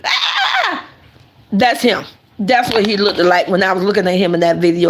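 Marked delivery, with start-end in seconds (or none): 0.0-0.9 very high, laughing mock shriek; high comic witch screech, half laughed; witch scream. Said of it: "she screams".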